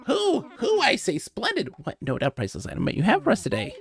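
high, gleeful owl hooting with delight; a bird bursting with excitement; thrilled owl curator. Acted. Owl curator receiving a footprint, high excited hooting.

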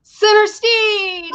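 stretched, high announcer call. Pretend. Host welcoming the guest in a slow, high announcer call. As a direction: bring the guest on like a show host.

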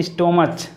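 stomach misread as "istomach"; wrong stomach, o and ch. Shown as a demonstration. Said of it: "लेकिन ये गलत है". (stomach का गलत उच्चारण, स्टोमच)

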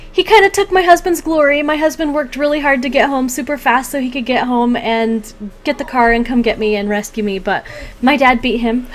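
normal talking voice

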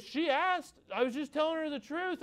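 put-on high-pitched protesting kid voice; defensive young boy. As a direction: imitate a child defending himself. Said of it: "and he goes".

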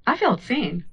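ordinary talk, nothing marked

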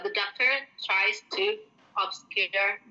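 re-read it slowly; slow corrected example. Shown as a demonstration. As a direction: slowly, stress on "doctor". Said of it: "Or, sorry". (dibaca ulang pelan-pelan)

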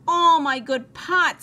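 slow, high, loud dismay; storybook wail of dismay; dismayed outcry. A narrator's reading. Missus Rogers sees her pots ruined.

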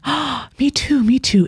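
high, eager me too; eager agreement. Retold or imitated. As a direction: leap in eagerly. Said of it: "I'm like".

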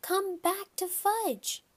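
put-on high-pitched little kid calling; high, drawn-out childish call; small child calling out. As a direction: call out high like a toddler to birds. Fudge calls to the birds.

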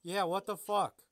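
loud, incredulous outburst; exasperated. Real, not acted. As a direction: blurt out in disbelief. Outraged at collarbones.

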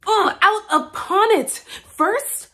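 high, loud cry of disgust; exasperated outburst. Acted. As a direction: spit it out in frustration. Outburst in the monologue.

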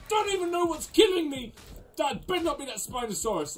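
ordinary talk

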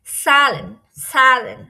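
"সালেন" said loud with a long a; opening consonant demo. Shown as a demonstration. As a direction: model "সালেন" loudly, one careful word at a time. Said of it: "ছয়ের মতো উচ্চারণ হবে".

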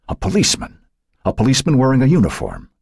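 high-pitched, startled realisation; alarmed. A narrator's reading. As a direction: gasp out the discovery in alarm. Alarmed at sight of policeman.

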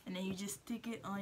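lip held back by finger; talking while touching teeth; finger in mouth. Real, not acted. Seen 0: Finger pushes lip aside onto braced teeth during first half.

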